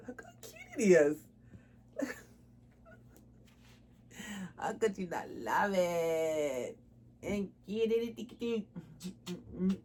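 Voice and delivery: fond doll-mom gushing; cooing at the doll. Real, not acted. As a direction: coo over how cute the little hoodie is. Cooing over the reborn doll.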